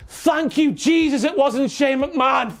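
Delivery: usual speaking voice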